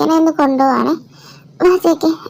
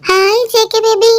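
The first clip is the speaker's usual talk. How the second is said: flirty greeting; 5 semitones higher than usual. Acted.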